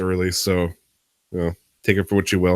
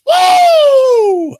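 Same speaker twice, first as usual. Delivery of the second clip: high, loud drawn-out woo; revved-up whoop; 11 dB louder than usual. Real, not acted. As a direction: whoop yourself into overdrive. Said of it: "rev myself up into overdrive mode".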